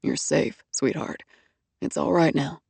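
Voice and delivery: low, soft reassurance; calm her down gently; soothing. A narrator's reading. Hardy soothing the shivering woman, soft and low.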